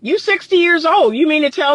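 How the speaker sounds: put-on high, laughing disbelief at a sixty-year-old; a hint of laughing disbelief; incredulous disbelief. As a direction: scoff in high-pitched amazement, laughing. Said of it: "And I'm like".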